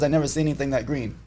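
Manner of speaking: usual speaking voice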